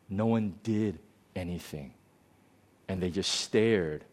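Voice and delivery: a touch of quiet pain in the retelling; quiet hurt. Retold or imitated. Student recalling racism on a pop show, quietly hurt. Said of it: "he was getting quite emotional".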